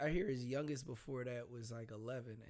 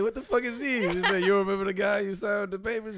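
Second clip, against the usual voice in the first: loud shout; 8 semitones higher, 13 dB louder than usual. Retold or imitated. Retold angry boyfriend.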